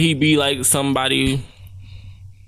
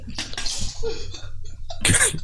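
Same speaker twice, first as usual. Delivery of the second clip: high, airy burst of laughter; breathless laugh; 6 dB quieter than usual. Real, not acted. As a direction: wheeze out a silent laugh. Cracking up at friend's joke.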